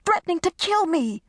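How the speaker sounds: high, indignant holler. Acted. Desiree hollering back at Carmen's advice, high and indignant. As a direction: throw the words back at her in outrage. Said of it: "Desiree hollered".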